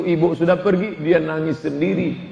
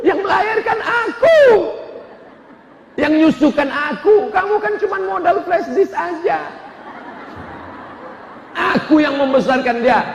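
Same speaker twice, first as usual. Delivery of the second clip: high, shrill-free indignant rebuke, much higher; indignant scolding; 8 semitones higher than usual. Retold or imitated. Wife rebuking husband who only gave a flash disk, high indignant scolding. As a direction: scold him with rising indignation.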